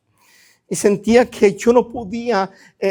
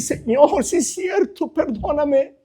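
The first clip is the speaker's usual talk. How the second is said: retold pleading prayer; 7 semitones higher, 4 dB quieter, slower than usual. Preacher re-enacting asking God's forgiveness, high-pitched pleading. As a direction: re-enact a repentant plea, pitched up.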